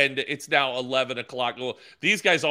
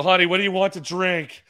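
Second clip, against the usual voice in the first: raised, louder showman voice; mock announcer to wife; 6 semitones higher, 6 dB louder than usual. Husband hamming it up at the drive-thru, raised showy voice. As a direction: play it up like a host calling to the car. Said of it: "I put on a whole show".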